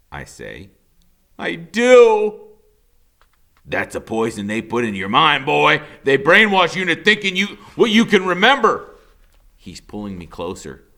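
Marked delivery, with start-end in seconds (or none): 1.4-2.5 put-on boy insisting loudly. A narrator's reading. 3.6-9.0 louder stern father voice, pressing; stern angry father. A narrator's reading. Father scolding his son, angry and loud.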